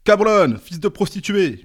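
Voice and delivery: high, loud yell of abuse; a worker yells an insult at the boss; screamed insult. A narrator's reading. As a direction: scream it at him. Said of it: "hurla un autre".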